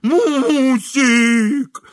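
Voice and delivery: high, loud, long-drawn wail; uncontrolled yell. A narrator's reading. Hungry husband dreaming of roast goose, yelling for his wife. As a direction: yell it out slowly, stretching each word. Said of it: "не в силах сдержать себя завопил".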